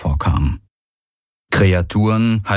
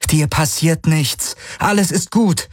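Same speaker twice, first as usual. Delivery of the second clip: trembling reassurance; 8 semitones higher than usual. Acted. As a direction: soothe the animal while afraid. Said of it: "mit bebender Stimme".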